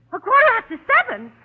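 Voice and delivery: the penny drops, she panics; alarmed realisation. Acted.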